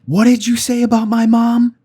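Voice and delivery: high, loud outraged yell; acted-out furious scream; retold scream. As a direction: shriek it like an enraged daughter-in-law. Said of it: "she turned around and screamed".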